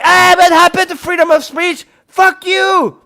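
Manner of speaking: high, loud mimicked complaint; hammed-up angry whine; mock outrage. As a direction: imitate an indignant complainer at full voice. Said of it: "you know, like".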